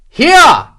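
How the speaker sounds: high, loud one-word shout; irritable call. A narrator's reading. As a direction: yell your position, annoyed at being asked. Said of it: "Morris called irritably".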